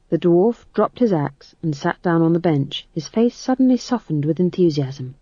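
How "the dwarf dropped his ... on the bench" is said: plain reading voice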